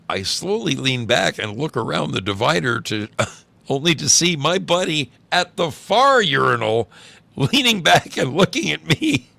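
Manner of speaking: storytelling on the edge of laughter; animated story reading. A narrator's reading. Reads story, building to the reveal.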